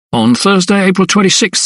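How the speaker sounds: high-pitched clerk reading out; informing clerk. A narrator's reading. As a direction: read the date in a high clerk's voice. Clerk reads out date of death.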